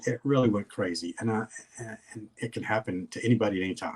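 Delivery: ordinary talk, nothing marked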